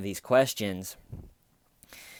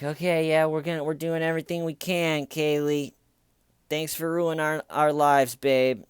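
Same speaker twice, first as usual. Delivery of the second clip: sarcastic mock reply; 6 semitones higher, 4 dB louder than usual. Parents mocking Kaylee's demands.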